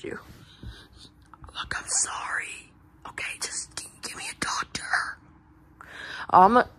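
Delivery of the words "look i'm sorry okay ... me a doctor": soft, pleading whisper; lost voice. Acted. Nightmare asking Blue Bear for a doctor, lost voice. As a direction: plead voicelessly, all air. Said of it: "you'll lose your voice".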